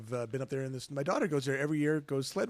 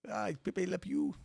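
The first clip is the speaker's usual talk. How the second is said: slow, higher cartoon French accent; 4 semitones higher, slower than usual. Shown as a demonstration. Co-host answering "France" on request, French accent. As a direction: draw it out in mock French. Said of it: "do the accent for those names".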